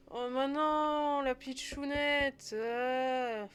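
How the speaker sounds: high, drawn-out lament; dismayed wail. Real, not acted. Player mourning the little girl in the game, dismayed wail.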